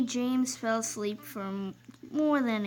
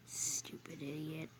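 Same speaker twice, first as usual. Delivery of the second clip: much lower, very soft aside; muttered insult; 7 semitones lower, 14 dB quieter than usual. Acted. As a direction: mutter it under your breath.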